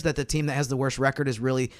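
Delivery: usual speaking voice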